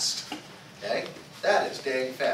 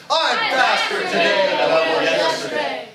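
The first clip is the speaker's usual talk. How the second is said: much higher and louder, full of energy; with enthusiasm; 12 semitones higher, 13 dB louder than usual. Shown as a demonstration. Instructor pumping up students, loud and enthusiastic. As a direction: shout it out like you believe it. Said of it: "let's say it with enthusiasm".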